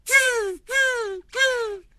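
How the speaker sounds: very high squeaky tz tz tz; monkey chatter. Acted. Monkeys mocking the peddler, high squeaky chatter. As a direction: chatter back like cheeky monkeys.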